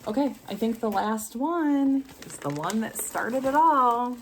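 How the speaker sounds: usual speaking voice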